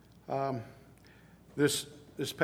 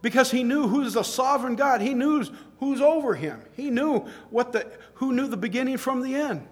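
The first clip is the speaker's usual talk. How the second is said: excited preaching; 9 semitones higher, 5 dB louder than usual. Real, not acted. Preacher exclaiming in admiration.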